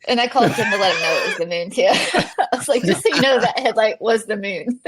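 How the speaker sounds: loud, talking through laughter; laughing retelling. Real, not acted. Runner recalls phoning Tim about the moon, laughing loudly. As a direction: crack up while retelling it. Recounting a comic phone call.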